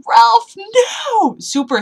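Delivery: high, loud anguished cry; screaming at the screen; shouted plea. Retold or imitated. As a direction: yell his name in horror. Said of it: "And I was like".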